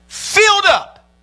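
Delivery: high, slow stretched cry of joy; excited climax. Real, not acted. Preacher reaching the brimming last vessel, high stretched excited cry. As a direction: lift it high and draw it out.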